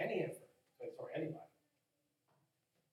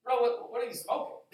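mock incredulity; 6 semitones higher, 8 dB louder than usual. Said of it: "you're gonna have some response and say".

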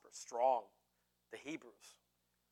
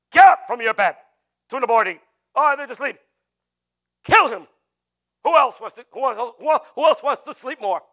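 hammed-up ruthless Pharaoh, very loud and higher; tyrant's shout; 7 semitones higher, 15 dB louder than usual. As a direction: roar orders like a slave driver. Acts Pharaoh rousing sleeping slaves.

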